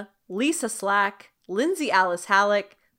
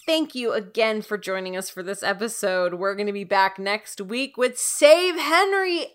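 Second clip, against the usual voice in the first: ordinary talk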